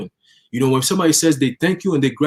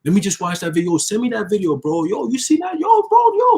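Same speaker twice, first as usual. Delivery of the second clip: hyped-up viewer; 6 semitones higher than usual. Retold or imitated. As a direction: get more and more worked up until you shout.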